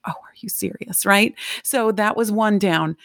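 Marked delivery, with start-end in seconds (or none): 0.1-1.0 breathy whisper of disbelief; whispered aside. Retold or imitated. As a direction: whisper it under your breath. Her aside reaction.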